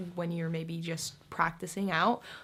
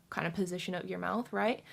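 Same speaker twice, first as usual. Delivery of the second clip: ordinary talk, nothing marked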